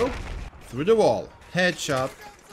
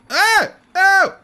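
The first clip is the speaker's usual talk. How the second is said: two short, high, loud yells; startled cries; 13 semitones higher, 10 dB louder than usual. Real, not acted. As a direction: yelp as if blinded suddenly. Flashed in the game.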